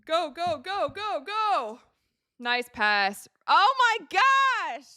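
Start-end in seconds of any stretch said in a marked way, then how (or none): 0.1-1.8 high excited cheering, urging the team on. Real, not acted. Cheering on a fast break. 3.5-4.9 loud, high, stretched out oh my gosh; excited exclamation. Real, not acted. Reacting to a big play.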